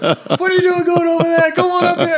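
very high, loud mock British painter calling out; high-pitched yelling imitation, laughing through it; Peter Mayhew impression. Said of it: "this is exactly how he sounds".

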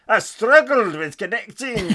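slow, loud mock Scottish accent, hammed up; Scottish accent attempt. Podcast host reading a listener's email in a mock Scottish accent. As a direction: have a go at a Scottish accent, half laughing at yourself. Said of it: "I can't do a Scottish accent".

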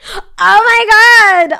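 high, loud, drawn-out squeal; real shocked excitement. Real, not acted. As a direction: squeal it slowly in delighted shock. Reacts to a name just revealed.

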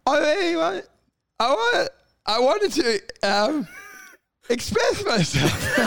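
halting, high-pitched stammer; mock nerves, faltering with long gaps; nervous interviewee. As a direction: stumble through it like a job interview. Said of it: "like you're in a job interview".

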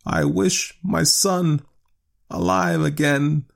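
very slow, high-pitched solemn wish; reluctant wish. A narrator's reading. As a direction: speak the wish haltingly, in dread. Reluctant wish in acted story.

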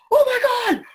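high, loud delighted yell; the director thrilled, shouting out; excited shout. Retold or imitated. Director yells as he hears the take, high and loud. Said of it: "I see Danny go".

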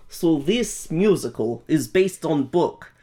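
mock Russian accent. Host joking through the intro, mock Russian accent. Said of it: "in the Russian accent".

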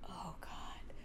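barely voiced, very soft aside; whispered inward groan of dread; hushed dread. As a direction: breathe it out almost silently in dismay. Acting out her inner dread.

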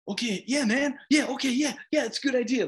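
high-pitched, eager-to-please yes-man; eager puppy dog. Acted. Sidekick agreeing with his best bud, puppy-like eagerness. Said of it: "Like a, a puppy dog".

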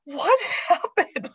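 slow, amazed question through a laugh; laughing disbelief. Retold or imitated. Mom at night amazed at her day, laughing. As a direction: laugh at how the day turned out. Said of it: "I was like".

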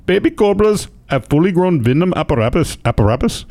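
put-on Indian accent for a quote. News host quoting the snake trapper, Indian accent. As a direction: read it in an amateur Indian accent. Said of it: "when you do an Indian accent".

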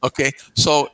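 ordinary delivery

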